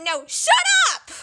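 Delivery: sharp, high yell of no, shut up; loud high protest; shout. Acted. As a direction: bark it back at them. Sibling quarrel, yelling back.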